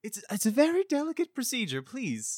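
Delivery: a prim medic shooing the patient; high-pitched prissy doctor; fussy doctor voice. Acted. Doctor NPC defends his work.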